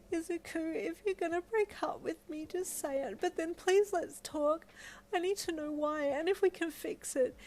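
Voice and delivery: a high, emotional cry begging to talk it out. Acted. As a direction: push through the tears, desperate to fix it. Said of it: "you cried".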